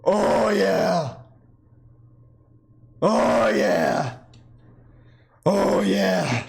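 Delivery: a strange, mock character voice on a drawn-out oh yeah; odd put-on voice. Chess streamer trying out an odd new voice, lightly put-on. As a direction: slip into a weird silly voice. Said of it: "I don't even know what this voice is".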